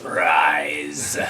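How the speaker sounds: low booming Sith command; hammy evil emperor command; bad acting. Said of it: "Worst acting ever".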